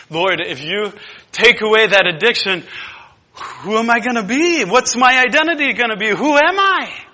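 high, loud, desperate pleading; pleading sinner. Acted. A frightened addict crying out to God, high and loud. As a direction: beg God not to take it away.

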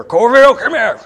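high, loud holler across the base; sergeant calling out. Retold or imitated. Sergeant summons airman back from AWOL, hollering. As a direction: bark it like a sergeant summoning a man. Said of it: "he called me over there".

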